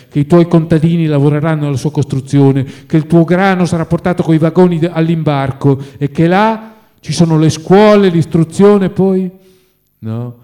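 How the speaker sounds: impassioned. A narrator's reading. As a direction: proclaim the future to a lost friend. Friend's impassioned inner farewell.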